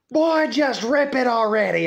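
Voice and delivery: a father losing patience with his son; impatient dad. Retold or imitated. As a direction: hurry the kid along.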